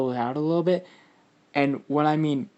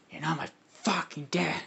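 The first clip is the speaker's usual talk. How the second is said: softer, higher petulant retort; lightly voiced bickering creature; 4 semitones higher, 5 dB quieter than usual. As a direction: argue back quietly, pitched up a little. Acted bickering between the two symbiotes.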